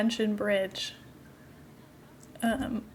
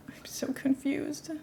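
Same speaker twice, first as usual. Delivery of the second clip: whispering; 3 semitones higher, 3 dB quieter, slower than usual. Real, not acted. Confused by animals boxed up.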